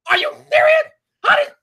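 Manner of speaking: can't believe what you're hearing; incredulous outburst. Real, not acted. Fuming at Apple for missing Game of Thrones, incredulous.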